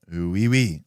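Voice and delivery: a quick, jokey French "oui, oui"; light mock French accent. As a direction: toss off a hammed-up French accent. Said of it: "if you wanna see a really good French accent".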